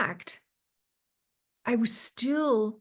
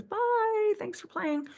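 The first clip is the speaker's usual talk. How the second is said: mock cheery dismissal; 10 semitones higher, slower than usual. God ejecting her, mock dismissal.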